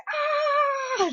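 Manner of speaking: high, drawn-out happy squeal; delighted "ah". Retold or imitated. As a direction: squeal at a surprise gift. Retold delighted reaction.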